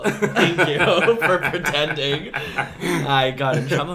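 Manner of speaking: laughing thanks. Real, not acted. Thanking the host for pretending, laughing loudly. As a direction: talk through a laugh, loudly. Amused at the sickle joke.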